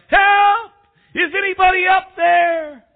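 high, desperate full shout; calling for help. Acted. Man hanging from a cliff calls up for help, shouting. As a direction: yell it up to someone far above. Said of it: "he called out".

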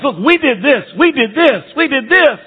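high mocking boast, each we did this pushed up; self-congratulating boasters. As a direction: mimic proud churchgoers listing their deeds. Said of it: "They're focused on themselves".

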